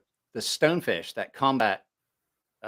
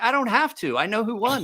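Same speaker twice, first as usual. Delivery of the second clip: high, knowing, smug retort; a much higher, cocky know-it-all reply; 10 semitones higher than usual. Retold or imitated. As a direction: say it like it's obvious.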